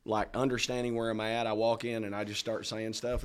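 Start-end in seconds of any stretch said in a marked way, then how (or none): none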